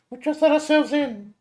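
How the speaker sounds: high, loud Nami calling out; high girl voice calling. Acted. Nami calls into the empty mansion, high girl voice. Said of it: "Nami also shouted out".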